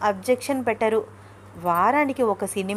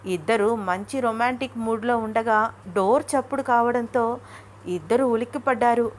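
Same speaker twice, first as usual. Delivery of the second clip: usual speaking voice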